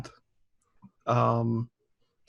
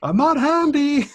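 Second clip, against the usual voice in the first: high, slow, laughing mock wail; hammed-up laughing self-pity; laughing confession; 13 semitones higher, 4 dB louder, slower than usual. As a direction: laugh through a mock lament.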